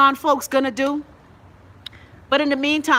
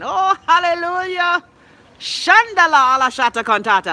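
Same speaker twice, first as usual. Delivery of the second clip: mock speaking in tongues; 8 semitones higher, 6 dB louder than usual. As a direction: play a churchgoer catching the spirit, in jest. Mock church praise, fake tongues.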